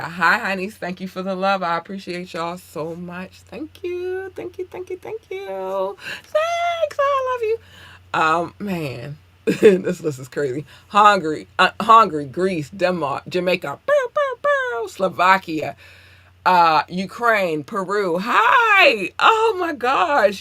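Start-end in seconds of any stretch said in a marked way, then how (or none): 3.4-7.6 very high, tiny squeaky thank-yous; squeaky cutesy voice. Pretend. 13.9-14.9 very high, slow little barks; squeaky bow bow. Pretend. Imitated noise while listing. 18.2-20.4 high, loud overjoyed greeting; excited squeal. Real, not acted. Gushing over listeners.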